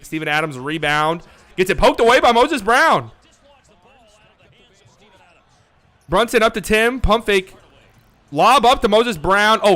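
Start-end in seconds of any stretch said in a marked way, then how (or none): 1.6-9.8 louder, higher rapid play-by-play; excited call. Real, not acted. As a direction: call the play like it's big.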